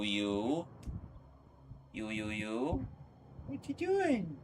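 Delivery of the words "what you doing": much higher cutesy voice for the pet. Real, not acted. Streamer coos at her cat in a much higher voice.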